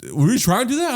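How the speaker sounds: high, stumbling question that rises at the end; imitated incredulity. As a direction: mimic the baffled barbers. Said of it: "And then they're like".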